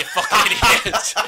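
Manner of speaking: laughing through the words; giggling. Real, not acted. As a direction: crack up mid-line.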